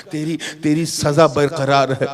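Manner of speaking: usual speaking voice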